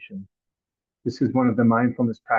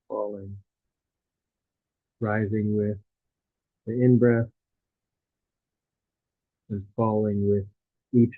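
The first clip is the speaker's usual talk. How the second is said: guided meditation; 2 semitones lower than usual. Real, not acted. Teacher guiding listeners through a body scan, calm meditative delivery.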